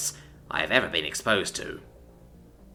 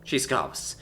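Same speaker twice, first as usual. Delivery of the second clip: ordinary delivery, nothing marked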